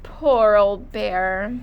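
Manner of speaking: slow, mock-sympathetic sigh. A narrator's reading. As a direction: fake pity, drawn out slowly. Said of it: "sighed Hare".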